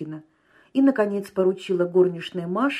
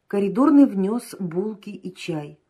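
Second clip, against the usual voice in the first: ordinary delivery, nothing marked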